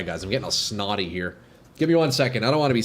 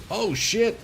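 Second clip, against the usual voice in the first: startled yelp; 11 semitones higher than usual. Real, not acted. Sudden surprise in game.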